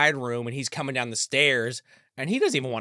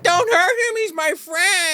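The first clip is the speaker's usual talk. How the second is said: put-on high-pitched, louder crying child voice; crying child plea; 4 dB louder, slower than usual. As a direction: plead like a scared child protecting a friend. Judy pleading in retold scene.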